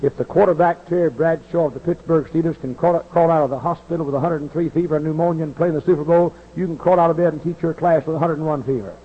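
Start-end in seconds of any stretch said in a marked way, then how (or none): none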